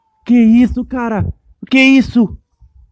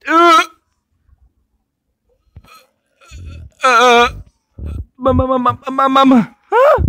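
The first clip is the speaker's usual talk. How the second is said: petits cris paniqués aigus; cris de panique; 8 semitones higher, 7 dB louder than usual. Real, not acted. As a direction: crie de peur, affolé. Panique, cris aigus dans le jeu.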